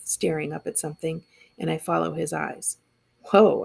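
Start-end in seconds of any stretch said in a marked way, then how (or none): 3.3-3.6 gasp. Acted. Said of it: "I gasp".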